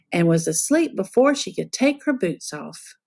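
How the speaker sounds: ordinary delivery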